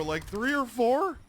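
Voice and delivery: high, slow mocking tone; mock whiny voice. Mocking voice held while scoffing at the stream count. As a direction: drag it out in a mock whine.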